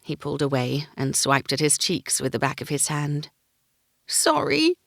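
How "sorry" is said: choked up. Acted. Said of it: "he choked out".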